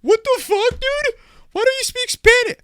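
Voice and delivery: very high, loud squeaky imitation; mock them in a shrieking high pitch; mock outrage. People finding out he has no Spanish, mock outrage. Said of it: "they're fucking like".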